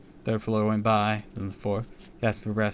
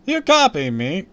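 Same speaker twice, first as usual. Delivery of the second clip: loud, high showman; hammy celebrity charm; TV host voice; 10 semitones higher, 11 dB louder than usual. Acted. Host voice again.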